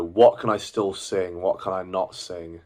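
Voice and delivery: usual speaking voice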